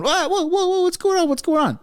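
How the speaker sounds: high, far-off mocking voice of startled people; mock panic. Mocking everyone reacting to the tariff threat, high squealing panic. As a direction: squeal it like alarmed onlookers mocking their shock. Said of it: "And everyone's going".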